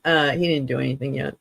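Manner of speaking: ordinary talk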